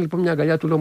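usual speaking voice

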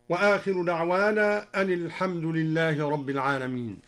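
ordinary talk

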